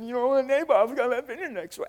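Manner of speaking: high, whining sulky voice; whiny king. Retold or imitated. Weeping king pouring out his heart to his wife, in a high whine.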